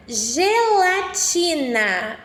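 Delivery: each syllable slow and clear; slow dictation. Shown as a demonstration. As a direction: dictate slowly to children. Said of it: "Vou falar pausadamente".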